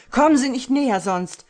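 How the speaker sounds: high loud warning shout; panicked shout. Acted. Husband warning the killer off, loud panicked shout. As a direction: scream him back in terror.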